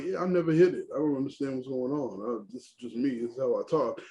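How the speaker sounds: low, soft retelling of a shrug-off; low-key self-defense. Retold or imitated. Radio host explaining his natural way of talking, low-key. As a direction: drop it low and quiet, imitating him.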